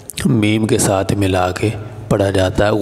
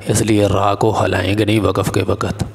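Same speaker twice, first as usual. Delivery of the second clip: usual speaking voice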